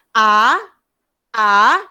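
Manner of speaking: rising Mandarin second-tone A. Shown as a demonstration. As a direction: release A upward with force. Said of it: "dia lepaskan ke atas".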